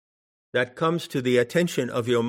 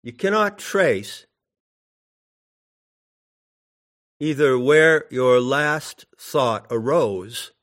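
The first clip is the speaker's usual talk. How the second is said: solemn recitation; 4 dB louder than usual. Acted. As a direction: intone the teaching weightily and deliberately. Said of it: "And it goes like this".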